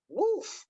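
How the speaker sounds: a sharp high yelp of a sound; woof. Retold or imitated. Money yelping as it runs off. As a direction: toss out a quick high whoop.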